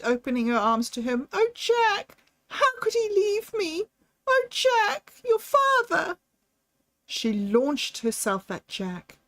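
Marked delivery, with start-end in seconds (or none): 1.3-6.2 much higher, sobbing wail of a new widow. Acted. Widow sobs to her son over his father's death, high wailing. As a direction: cry it out through tears, pitched very high. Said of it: "as she sobbed".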